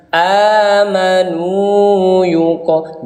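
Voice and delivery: Amanu yuko slow with nu held long. Shown as a demonstration. Teacher reciting a correct long vowel. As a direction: draw out the nu of Amanu. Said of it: "Nunya dipanjangkan".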